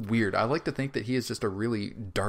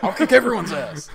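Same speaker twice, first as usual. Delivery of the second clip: high, loud laughing boast; hammed-up threat through a laugh; mock tough guy; 11 semitones higher, 8 dB louder than usual. Mocking the Schwimmer character.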